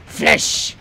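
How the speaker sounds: very loud, high yell; shouted callout. Real, not acted. Player calling out at Flesh in the game, shouted callout. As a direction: holler it across the map.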